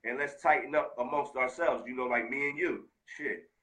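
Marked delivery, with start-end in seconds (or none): none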